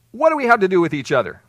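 mildly acted loud objection; loud challenge. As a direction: throw it out as the demon's challenge. Acting the demon's challenge to Jesus.

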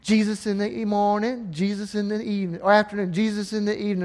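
ordinary delivery

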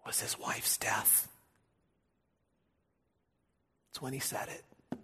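hushed, breathy whisper. Acted. Preacher telling of Abraham's wife's death, whispered. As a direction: let it fall to a whisper.

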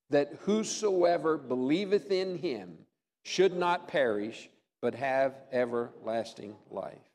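slow, measured scripture recitation; reciting scripture together. Real, not acted. Pastor leading the congregation in John 3:16, slow solemn recitation. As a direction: lead the congregation through the verse slowly. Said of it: "say it with me".

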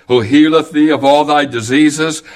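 ordinary talk

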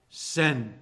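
ordinary talk, nothing marked

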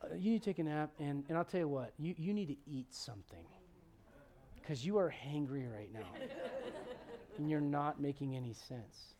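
low, soft, patient voice; quiet, kindly parental advice; gentle. Retold or imitated. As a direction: calmly coax someone overtired and cranky. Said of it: "He just very gently goes".

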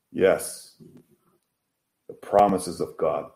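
ordinary delivery

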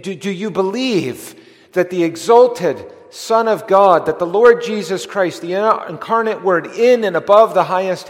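high, loud pulpit appeal; preaching at full force. Real, not acted. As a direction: thunder the question at the congregation. Preacher challenges the congregation.

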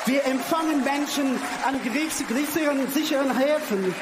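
normal talking voice

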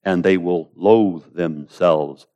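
hushed, patient promise; calm reassurance. Acted. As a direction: answer the protest quietly and surely. Acts out God's calm reply.

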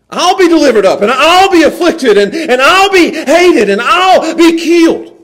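fervent climb, high and loud; rising preaching fervor. Real, not acted. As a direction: build it to a preaching peak. Preacher building to climax.

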